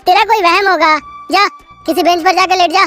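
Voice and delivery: dismissive. Acted. Suresh dismisses narrator.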